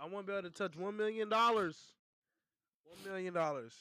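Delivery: loud, high, exasperated correction; emphatic clarification. Real, not acted. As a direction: raise your voice to make it clear. Repeats after being misheard and mocked.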